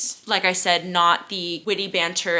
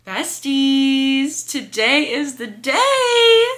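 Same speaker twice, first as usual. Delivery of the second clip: slow, higher, louder with a teary edge; excited; 5 semitones higher, 4 dB louder, much slower than usual. Real, not acted. Telling besties the book arrived, excited. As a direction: announce it with emotion. Book delivery day, emotional.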